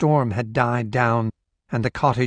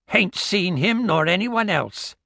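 put-on high old crone voice; old woman voice; 8 semitones higher than usual. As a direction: voice a suspicious old Scots woman. Old woman answers stranger.

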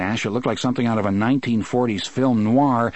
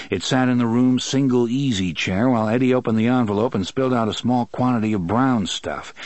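ordinary delivery, nothing marked

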